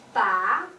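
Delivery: "Ba" starting mid-low and sliding up; rising second tone. Shown as a demonstration. Teacher models second tone for students, rising. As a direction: lift "Ba" from middle pitch upward. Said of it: "you need to go move up a little bit".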